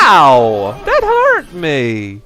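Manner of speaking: loud puppet yelp; mock ouch. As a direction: cry out as the hurt puppet. Puppet bumped, cries out.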